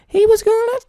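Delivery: exaggerated high-pitched voice demo. Shown as a demonstration. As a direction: squeak the greeting in falsetto. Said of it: "raising your pitch of your voice".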